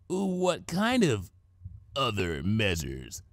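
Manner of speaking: slow, dropping, uneasy; wary question. A narrator's reading. Dash warily asking Twilight what she means, slow and uneasy. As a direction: ask warily after the pause. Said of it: "Dash asked, wary".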